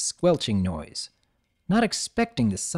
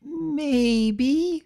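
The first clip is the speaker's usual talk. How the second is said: sheepish. A narrator's reading. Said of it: "Sweetie's cheeks turned rosy".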